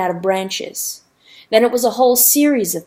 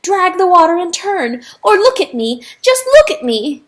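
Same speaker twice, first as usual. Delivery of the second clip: much higher, loud pleading; desperate calls; 9 semitones higher, 7 dB louder than usual. Acted. Rooster begging a passing boat, high loud pleas. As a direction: beg them to look, yelling.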